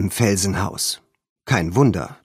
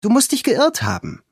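dismissive friend; 14 semitones higher than usual. A narrator's reading. Friend dismisses the girl's claim, high scoffing. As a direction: brush off her worry.

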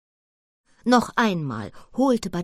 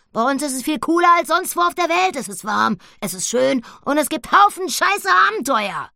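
high, loud, gushing pig voice; a piglet selling her home; enthusiastic piglet; 10 semitones higher, 7 dB louder than usual. Acted. Annabella praises their home. (Annabella, begeistert, hoch und laut)

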